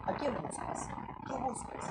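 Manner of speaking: hushed questions to someone despairing; quiet, consoling questions; soft, gentle questioning. Acted. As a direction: ask softly, offering help.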